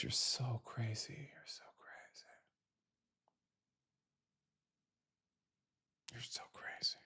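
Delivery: repeated mocking whisper. Taunting Trump voters in a whisper that fades. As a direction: whisper it at them, fading away.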